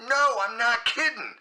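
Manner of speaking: high, drawn-up protest; exasperated high-pitched insistence; insistent. Retold or imitated. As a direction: imitate a caller swearing he's serious. Retold caller insists again.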